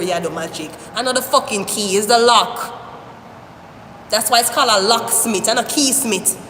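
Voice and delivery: usual speaking voice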